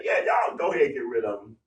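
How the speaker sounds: breezy brush-off, pitched low; shrugging off the killing, low and quick; casual dismissal. Retold or imitated. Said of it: "it was not for him to say".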